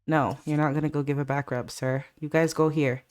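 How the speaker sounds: usual speaking voice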